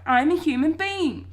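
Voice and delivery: high, loud, drawn-out insistence; shout. A narrator's reading. Boy insisting to his family he's human, shouting. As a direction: shout it slowly and firmly. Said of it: "I shout".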